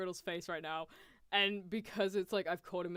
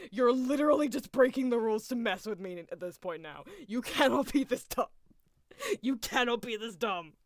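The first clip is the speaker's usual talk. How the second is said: louder, fed-up scolding; exasperated Riddle; 3 semitones higher, 6 dB louder than usual. Retold or imitated. Riddle fed up with Ace's rule breaking, exasperated scolding. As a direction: bite off each insult. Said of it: "he's like".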